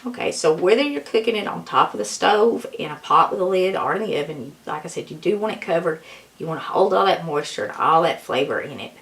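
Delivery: ordinary talk, nothing marked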